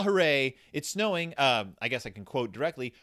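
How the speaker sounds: ordinary talk, nothing marked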